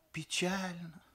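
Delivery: high-pitched regretful remark; disappointed grandfather. A narrator's reading. Grandfather sitting down after the groom's answer, disappointed. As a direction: lift the voice in old man's regret.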